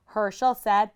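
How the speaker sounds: usual speaking voice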